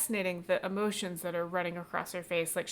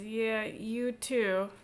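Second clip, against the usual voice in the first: slow, reluctant; 3 semitones higher, much slower than usual. Acted. Woman sidles away.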